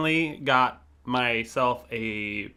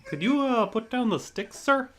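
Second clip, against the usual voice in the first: polite mock cop; 9 semitones higher than usual. Acting polite Canadian cop.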